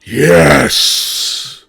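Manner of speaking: loud excited cheer; excited shout. Real, not acted. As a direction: yell it out in triumph. Player cheers at patron choice.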